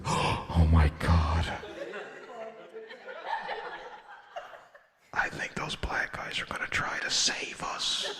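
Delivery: slow, breathless terrified whisper; scared whisper. Retold or imitated. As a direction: whisper it in panic. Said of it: "They were like".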